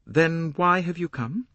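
high, abrupt question. A narrator's reading. Young woman challenges the detective's visit, abrupt. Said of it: "she asked abruptly".

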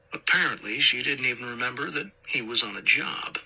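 ordinary delivery, nothing marked

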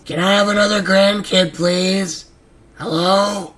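high, loud pleading yell; loud exasperated begging; shouted plea. Real, not acted. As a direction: shout the request across the room. Repeated begging finally shouted.

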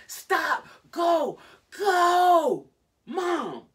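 very high, full-voiced screams; hysterical screaming. Retelling trembling Jade begging Mom to leave, hysterical screaming. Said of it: "Jade was just trembling and hysterical".

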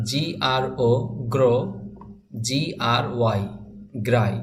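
slow letter-by-letter spelling, then the blended syllable. Shown as a demonstration. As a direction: spell each one aloud, pause, say the sound. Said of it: "বানান করে পড়লাম".

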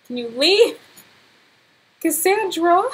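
loud, rising demand; exasperated calling. Real, not acted. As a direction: call out as if nobody is listening. Telling intruding Sim to leave.

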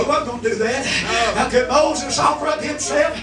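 ordinary talk

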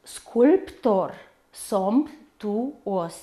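careful syllables: sculp-tor, somp-tu-os; despărțit în silabe. Shown as a demonstration. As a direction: pause at every syllable break. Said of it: "facem despărțirea în silabe astfel". (rostit atent, pe silabe)

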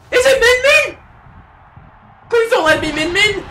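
high, loud panicky plea; panicked dread. Real, not acted. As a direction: cry out, begging it not to be true. Dreads the character reveal.

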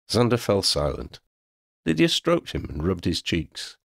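usual speaking voice

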